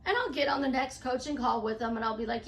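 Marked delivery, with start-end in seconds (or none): none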